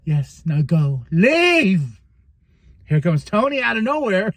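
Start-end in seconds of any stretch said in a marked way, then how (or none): 0.1-2.0 mock cold dismissal building to a command; lightly voiced villain sending her off; Mariah. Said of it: "she's like".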